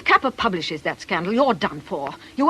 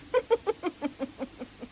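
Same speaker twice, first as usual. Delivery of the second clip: soft mocking laugh; 8 semitones higher, 7 dB quieter than usual. [name] mocks the scandal.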